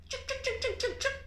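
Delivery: high, soft "chuk, chuk" puppet noises; playful chuk sounds. Acted. Teacher making the puppet play for children, high soft chuk sounds. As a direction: make the puppet hop with little squeaks.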